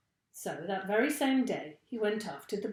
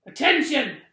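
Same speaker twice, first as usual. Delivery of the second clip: loud barked command; fearsome trainer's bark; 5 semitones higher, 11 dB louder than usual. A narrator's reading. Mister Twit commanding the monkeys, loud bark. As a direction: snap it out like a drill sergeant. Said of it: "he barked in his fearsome monkey trainer's voice".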